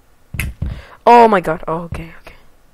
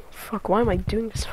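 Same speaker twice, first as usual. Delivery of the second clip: despairing whisper; 9 dB quieter than usual. Acted. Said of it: "fake voice crack".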